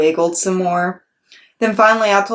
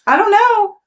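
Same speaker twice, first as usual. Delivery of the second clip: put-on quiet little girl; 14 semitones higher, 5 dB louder than usual. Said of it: "I said, kind of quiet".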